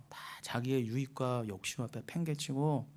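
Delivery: normal talking voice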